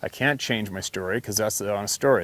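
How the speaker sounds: normal talking voice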